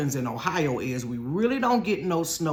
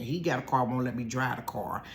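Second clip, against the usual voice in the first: ordinary delivery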